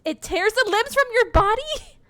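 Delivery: high, loud, rising disbelief; incredulous reading. Real, not acted. Player reads what the dog did, incredulous. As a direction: read it as an appalled question.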